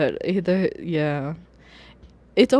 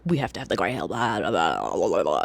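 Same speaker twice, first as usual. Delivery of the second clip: droning, much lower mock voice; mocking cocky doctor; 10 semitones lower than usual. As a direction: parody a pompous jerk going on and on. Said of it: "and he's just like".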